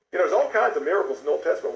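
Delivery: ordinary talk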